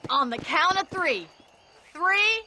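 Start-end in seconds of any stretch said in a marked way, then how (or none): 1.9-2.4 loud countdown, climbing to a yelled go; race start shout. Acted. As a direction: count them off and holler go.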